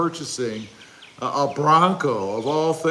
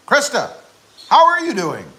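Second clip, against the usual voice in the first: calling out; 9 semitones higher, 7 dB louder than usual. Acted. Repeating greeting to absent wife.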